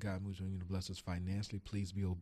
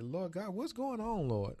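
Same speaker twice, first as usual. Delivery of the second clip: higher, slower retold pleading; recounting a prayer, pitched up; pleading with God; 11 semitones higher, slower than usual. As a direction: raise the pitch and plead slowly. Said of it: "I said".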